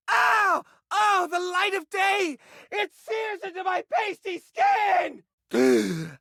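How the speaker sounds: slow, high, shouted vampire-like wail; hammed-up daylight agony. Hoarder blinded by daylight, hammed-up wail. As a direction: recoil from the sun theatrically.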